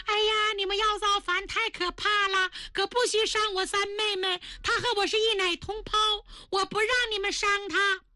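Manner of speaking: put-on high, tearful pleading girl's voice; crying young lady. A narrator's reading. Second daughter Lili begs them to spare her sister, tearful and high. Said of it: "二小姐丽丽哭了".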